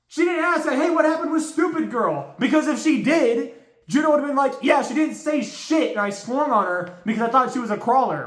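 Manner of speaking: a fan yelling at a dumb character; outraged rant. Real, not acted. Ranting furiously about Sarah.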